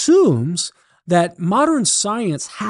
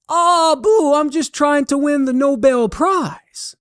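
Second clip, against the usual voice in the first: much higher Cajun voice, half-breathy reply; Cajun holler; 11 semitones higher than usual. Acted. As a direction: answer high and earnest in a Cajun accent. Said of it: "Thibodeau hollered back".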